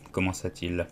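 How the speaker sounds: usual speaking voice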